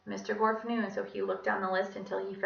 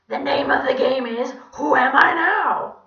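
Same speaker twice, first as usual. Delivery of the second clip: creepy French donkey; 6 semitones higher, 14 dB louder than usual. A narrator's reading. Villain proposing a game to trapped children, creepy. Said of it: "his normal creepy French donkey voice".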